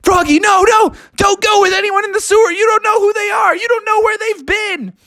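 high, frantic warning shout; alarmed friends. Acted. Friends beg Froggy not to go with strangers, frantic. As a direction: rattle it off fast in panic.

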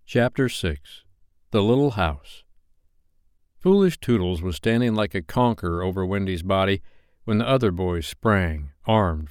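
ordinary delivery

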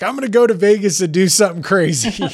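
high, loud, fast burst; YOLO excitement. Acted. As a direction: blurt it out like a wild impulse. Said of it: "a yolo moment where it's like".

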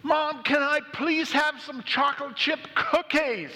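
put-on high-pitched three-year-old yelling for a treat; top-of-the-voice shout. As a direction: holler it high like a pleading little kid. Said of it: "He shouts, top of his voice".

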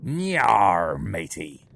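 put-on pirate accent, Yar matey. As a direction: talk like a pirate. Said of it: "struggling with your accent".

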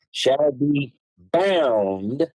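"shall be bound" spoken very slowly; slow emphasis. Shown as a demonstration. Host reading oath clause to viewers, very slow emphasis. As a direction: drag out "shall be bound" word by word. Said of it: "listen to the words as I reemphasize".

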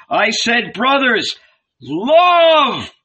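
high cry building to a loud last word; shouted appeal. A narrator's reading. Jesus pleads with his brothers, shouted. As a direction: appeal loudly, drawing out the end. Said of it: "he shouted".

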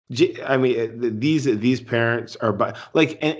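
ordinary delivery

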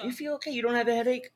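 high, soft imitation of concern; mock worried fussing. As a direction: mimic a fretting partner in a small high voice. Imitates her worried repeated questioning.

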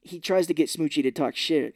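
ordinary talk, nothing marked

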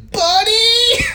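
high, loud call for Buddy. Retold or imitated. Lori calling for Buddy who isn't coming, loud high call. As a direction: yell his name across the yard.

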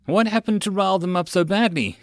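plain reading voice

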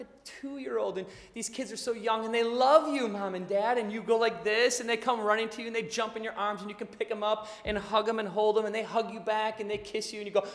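usual speaking voice

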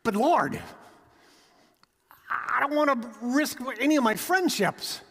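a nervous believer pleads with his Lord; anxious excuse. Acted.

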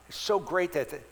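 ordinary delivery

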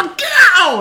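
high, very loud battle shout; sudden attack yell. Acted. Fighter turning around to hit his pursuer, sudden attack yell. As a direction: explode into a high-pitched yell.

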